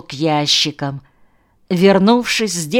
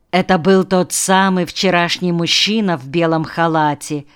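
ordinary delivery

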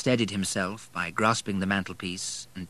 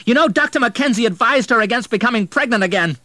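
high, loud accusing yell; angry shout; 10 dB louder, faster than usual. A narrator's reading. Son shouting accusations at stepfather, high and loud. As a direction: throw the doctor's warning in his face. Quarrel continues, William shouting.

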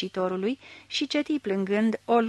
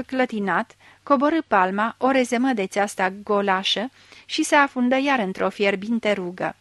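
plain reading voice

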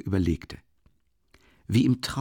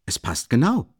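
mildly triumphant, a little louder; 3 semitones higher, 3 dB louder than usual. A narrator's reading. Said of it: "rief er triumphierend aus".

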